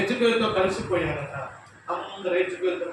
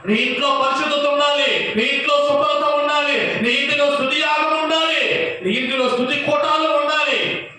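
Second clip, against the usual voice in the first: loud, raised preaching voice; preaching at full force; 8 semitones higher, 6 dB louder than usual. Acted. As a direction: drive the story home to the church.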